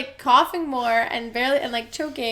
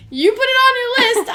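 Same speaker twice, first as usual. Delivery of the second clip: high, loud mock-exasperated retort; loud, high-pitched playful insisting; retold insistence; 12 semitones higher, 10 dB louder than usual. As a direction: act out an exasperated shout. Retold back-and-forth about the list.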